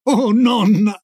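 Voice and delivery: put-on child's understated exclamation to grandma; mild cry of protest. A narrator's reading. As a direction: cry out without really shouting.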